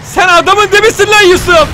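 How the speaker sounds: high, loud praise shouted at Yusuf; loud, high delighted shout; ecstatic cheer. Real, not acted. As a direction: yell it like a fan whose player just scored. Cheering the character after a rescue.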